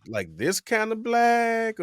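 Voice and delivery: very high, drawn-out query; mock questioning. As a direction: stretch it out high, teasing the client. Mocking the client's vague request.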